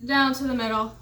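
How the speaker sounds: ordinary delivery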